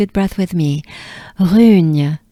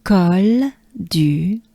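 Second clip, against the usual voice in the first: ordinary talk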